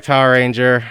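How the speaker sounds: usual speaking voice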